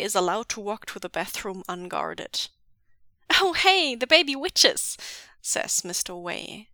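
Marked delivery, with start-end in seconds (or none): 3.4-5.0 bright, high, loud hello; breezy greeting. Acted. Mister Wei greeting the baby witches, bright and loud. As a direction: hail the kids cheerfully.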